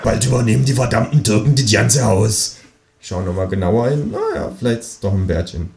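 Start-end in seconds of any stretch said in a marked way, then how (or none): 0.0-2.6 a hint of a nosy old neighbour; lightly voiced neighbour. Neighbour lady gossiping on the stairs, lightly voiced. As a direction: gossip in a slightly altered voice.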